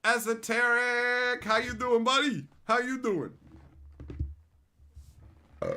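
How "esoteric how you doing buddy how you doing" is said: loud, high calling out; boisterous greeting. Real, not acted. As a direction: hail a buddy across the room.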